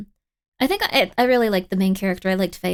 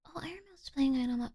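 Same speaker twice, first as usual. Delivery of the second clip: whisper; 2 semitones higher, 11 dB quieter, faster than usual. Real, not acted. Whispered aside.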